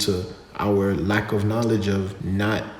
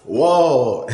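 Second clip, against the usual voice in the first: excited whoa; 5 semitones higher, 8 dB louder than usual. Acted. Outro jingle starts, sudden exclamation.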